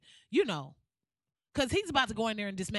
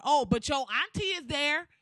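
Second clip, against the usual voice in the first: ordinary delivery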